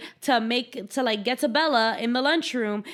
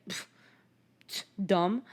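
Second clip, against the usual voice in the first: ordinary talk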